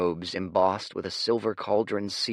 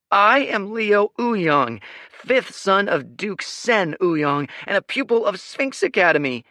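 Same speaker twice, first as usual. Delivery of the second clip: pompous yelp; 14 semitones higher, 9 dB louder than usual. A narrator's reading. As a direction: puff up and proclaim every title. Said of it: "he yelped".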